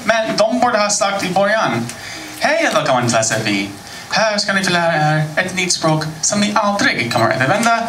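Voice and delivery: ordinary talk, nothing marked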